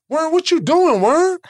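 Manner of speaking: high, loud call across the room. Retold or imitated. Young player calls out to Rodman on the treadmill, loud and high. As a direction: shout over to him on the treadmill. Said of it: "Well, fuck this".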